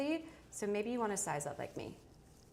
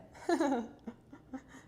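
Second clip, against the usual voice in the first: laughing; 6 semitones higher than usual. Real, not acted. Laughing at hair in mouth.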